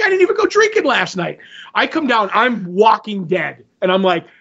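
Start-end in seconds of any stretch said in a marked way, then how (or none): 0.0-1.4 high, quick mock protest; put-on indignant squeal of denial; protesting innocence. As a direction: plead your case as the wrongly blamed brother. Brother voiced, protesting innocence.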